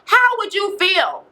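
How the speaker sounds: high, drawn-out yell of hurt challenge; retold yell. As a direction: imitate her yelling it out, pitched high and slow. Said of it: "she's just yelling that out".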